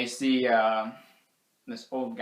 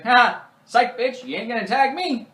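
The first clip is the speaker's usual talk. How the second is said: loud, high gloating jeer; mock taunting; 11 semitones higher, 9 dB louder than usual. Buzz fooling his sister during head count, taunting. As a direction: crow it at someone you just fooled.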